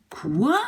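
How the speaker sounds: "quoi?" as kua, rising; French quoi demo. Shown as a demonstration. Teacher demonstrating the word for learners. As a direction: say quoi with a clear rise. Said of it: "that is where the quoi comes in".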